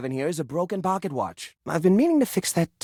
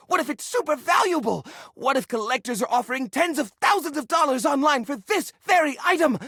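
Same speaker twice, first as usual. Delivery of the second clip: higher and higher with hype; rising excitement; 7 semitones higher than usual. Acted. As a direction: build the fantasy to a peak. Building excitement.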